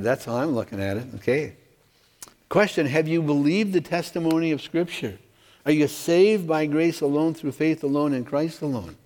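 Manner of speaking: ordinary talk, nothing marked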